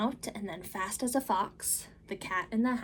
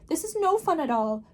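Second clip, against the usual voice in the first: much higher, louder, anxious little fish; fretful fish; 7 semitones higher, 7 dB louder than usual. Acted. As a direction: squeal out the protest as a fish. Fish protests.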